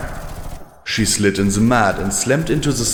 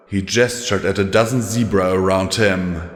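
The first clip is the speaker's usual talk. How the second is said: ordinary delivery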